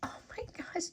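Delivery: high whispered oh my gosh; awed whisper. Real, not acted. Over the cute dog bead, a high awed whisper. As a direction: hush it like something precious.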